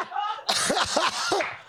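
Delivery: big high burst of laughter. Real, not acted. Laughs at her own story.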